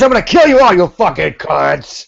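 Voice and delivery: high, loud ranting shout; sweary, shouty Doctor Who threatening Daleks; Stizer. Acted. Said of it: "a bit like Stizer".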